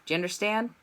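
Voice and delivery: ordinary delivery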